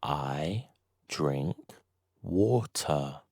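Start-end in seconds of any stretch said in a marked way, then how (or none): none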